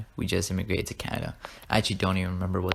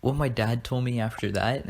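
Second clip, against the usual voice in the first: ordinary talk, nothing marked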